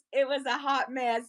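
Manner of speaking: normal talking voice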